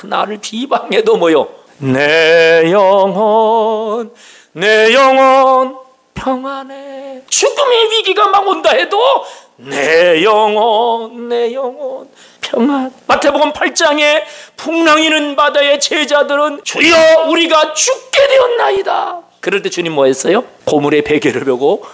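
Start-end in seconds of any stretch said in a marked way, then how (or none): 1.8-7.2 slow and savoured. Shown as a demonstration. Preacher savouring the peace of the soul for the congregation, very slow. 16.6-19.2 high crying shout of panic; terrified cry. Acted. Disciples crying to the Lord in the storm, a high panicked shout.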